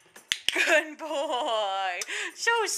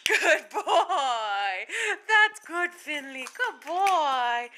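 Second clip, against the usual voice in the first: ordinary talk, nothing marked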